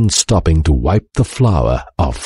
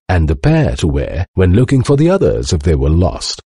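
plain reading voice

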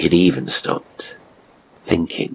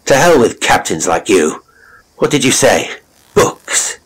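loud, raised furious retort; angry outburst; 5 semitones higher, 5 dB louder than usual. Acted. Jelwyn snapping at the drunk captain, loud and angry. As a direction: lash out at him, louder.

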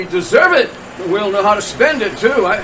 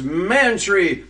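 ordinary delivery

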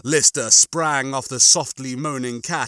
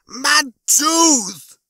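high, loud drawn-out wail; yelling; 13 semitones higher, 6 dB louder than usual. A narrator's reading. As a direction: wail it over your lost tooth. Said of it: "he was yelling".

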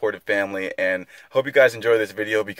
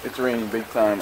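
ordinary talk, nothing marked

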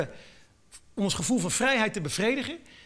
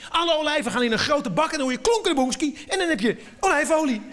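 ordinary talk, nothing marked

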